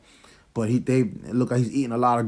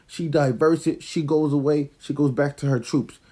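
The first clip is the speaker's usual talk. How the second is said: ordinary talk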